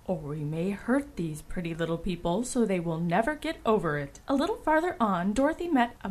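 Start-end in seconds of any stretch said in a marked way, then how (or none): 0.1-4.2 low, gentle woodman's voice; kind-hearted woodman. A narrator's reading. Woodman cautioning friends about the little people, kind-hearted. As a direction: drop the pitch for a caring caution. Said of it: "said the kind-hearted woodman".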